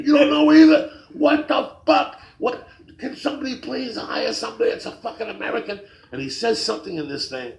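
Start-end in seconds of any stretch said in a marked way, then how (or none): 0.1-5.8 high, loud mock meltdown; losing it. Old man ranting at both sandwich workers, losing it. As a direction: rant as an old customer who has completely had it. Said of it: "he fucking lost it".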